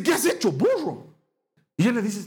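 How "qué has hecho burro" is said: sharp scolding cry; angry rebuke. Retold or imitated. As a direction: snap at him like an angry prophet. Samuel scolds Saul.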